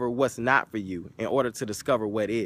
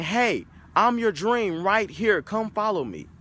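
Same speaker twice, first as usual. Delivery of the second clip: inviting billboard; 6 semitones higher than usual. Acted. Billboard voiced as calling out.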